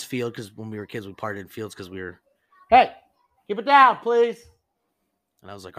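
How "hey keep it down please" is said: loud, high call to keep it down; real raised-voice request to people nearby. Real, not acted. Storyteller breaks off to hush noisy people, calling out.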